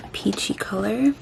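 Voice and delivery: ordinary talk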